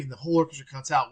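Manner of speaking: ordinary talk, nothing marked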